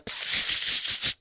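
unvoiced hiss for attention; whispered psst. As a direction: psst to get his notice. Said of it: "waiter goes".

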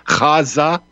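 "Gaza" as kha-ZA, throaty first sound, weight on the end; stress on second syllable. Shown as a demonstration. Host trying the guest's pronunciation, throaty kh and final stress. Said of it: "use the accent on the second syllable".

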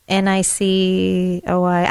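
Niçoise, lettre par lettre, lentement; spelling it out. Shown as a demonstration. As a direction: spell out N I C O I slowly. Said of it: "can you spell it?".

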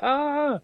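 very high-pitched excited squeal; imitated shriek of joy; mum's delighted squeal. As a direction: squeal like a thrilled mum. Imitates mum's reaction to good news.

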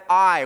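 "I" hit hard and loud on its own; emphatic I. Shown as a demonstration. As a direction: punch the single word "I" with weight. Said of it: "in the Greek, an emphatic".

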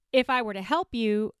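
usual speaking voice

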